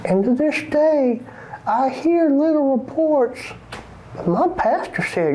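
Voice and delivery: normal talking voice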